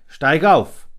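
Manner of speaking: loud curt royal command; commanding king. A narrator's reading. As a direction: order him up sharply. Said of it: "befahl der König".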